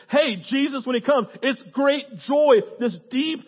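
loud, high, punched phrases; full-force preaching. Real, not acted. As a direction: drive each short line home to the crowd.

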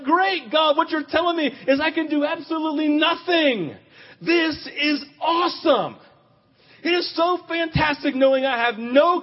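shouted, high, delighted disbelief; loud overjoyed shout; ecstatic outcry. Real, not acted. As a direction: cry it out to God in exhilarated irony. Exclaiming to God in ironic joy.

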